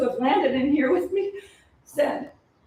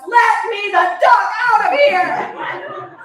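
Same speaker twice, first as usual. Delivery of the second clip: slow, high, louder yell; all-caps scream; 9 semitones higher, 8 dB louder, much slower than usual. Acted. Trapped in the pod, screaming to be let out. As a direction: scream it out in capitals. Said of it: "all caps".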